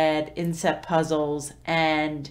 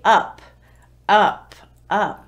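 single word, louder and playful; 3 semitones higher, 9 dB louder, slower than usual. Shown as a demonstration. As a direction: make "Up" bouncy and fun for a toddler. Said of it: "Make it playful, make it a little animated".

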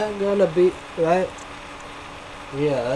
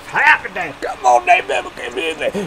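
much higher squeaky put-on voice; squeaky hype voice; 11 semitones higher than usual. Taunting the fast food chains, high squeaky taunt. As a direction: squeal it like a cartoon hype man.

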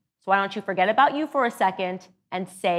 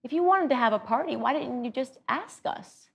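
soft, higher, tender disappointed question; gentle granddad; 5 semitones higher, 5 dB quieter than usual. Retold or imitated. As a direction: ask gently, hurt but loving. Granddad gently asking after party.

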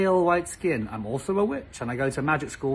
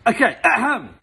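exaggerated ahem before reading; mock throat clearing; 7 semitones higher, 5 dB louder than usual. Shown as a demonstration. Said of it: "clears throat to begin".

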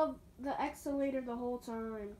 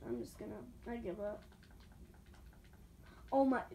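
ordinary talk, nothing marked

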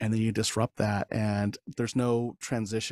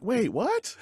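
baffled wait what; 11 semitones higher than usual. Retold or imitated. Stunned husband reacting.

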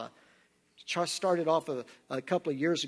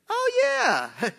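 a big high leap on yeah; mock recognition; 5 dB louder than usual. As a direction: act out a sudden realisation, hammed-up. Retold joke, sudden recognition.

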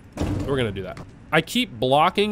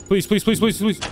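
higher, urgent begging; frantic pleading; 5 semitones higher than usual. Real, not acted. Player begging the game to spare him, frantic pleading. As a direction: plead rapidly for it to hold off.

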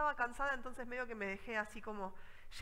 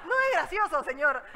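much louder, high, protesting; indignant outburst; 6 semitones higher, 13 dB louder than usual. Retold or imitated. Scolding the laughing trucker, loud and indignant. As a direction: snap back, offended.

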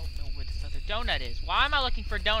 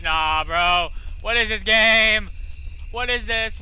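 freaked-out yell; 3 semitones higher, 7 dB louder, slower than usual. Real, not acted. Freaked out by the game.

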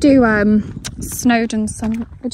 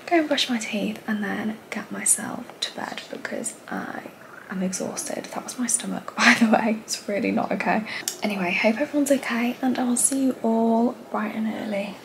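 soft sleepy whisper; bedtime whisper; 6 dB quieter than usual. Real, not acted. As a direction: whisper goodnight so nobody wakes.

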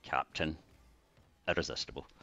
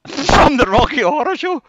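much higher, louder, laughing burst; laughing excitement; 14 dB louder than usual. Real, not acted. Remembering the party, laughing excited outburst. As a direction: blurt it out delighted.

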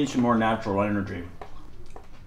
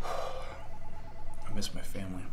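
whispered sadly; 5 semitones lower, 7 dB quieter, much slower than usual. Acted. Sudden wistful confession at work.

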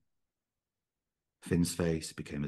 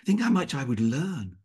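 eager youth; 10 semitones higher, 6 dB louder than usual. A narrator's reading. Eager prince dreaming aloud.

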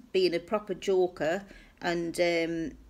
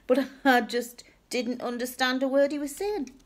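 ordinary talk

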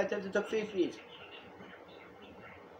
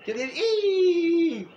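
excited shout; 10 semitones higher, 9 dB louder, much slower than usual. Real, not acted. Excited shouting about WWE.

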